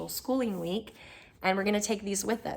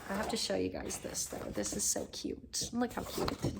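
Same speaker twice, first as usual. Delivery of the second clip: whispering; 6 dB quieter than usual. Real, not acted. Showing kids' art quietly.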